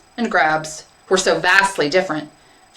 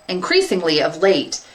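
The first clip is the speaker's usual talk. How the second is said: plain reading voice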